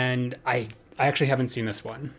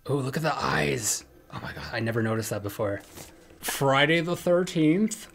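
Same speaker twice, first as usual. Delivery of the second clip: usual speaking voice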